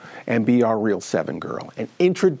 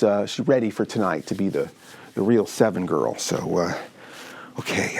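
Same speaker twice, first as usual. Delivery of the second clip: ordinary talk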